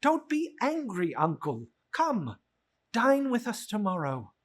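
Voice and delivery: a hint of the cheerful nephew, amused disbelief; lightly voiced nephew. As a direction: gently tease the grumpy uncle. Nephew invites uncle.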